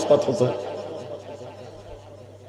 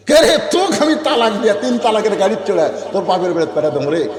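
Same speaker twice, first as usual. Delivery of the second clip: angry outburst; 5 semitones higher than usual. Acted. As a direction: explode at the wife, then settle into the threat. Enraged husband threatens divorce. (রাগে ফেটে পড়া স্বামীর হুমকি)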